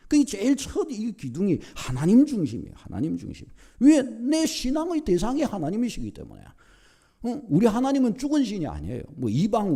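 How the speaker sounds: high-pitched sermon exhortation, falling at sentence ends; forceful preaching. Real, not acted. Preacher urges congregation to put God first, forceful preaching. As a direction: press the congregation with insistent emphasis.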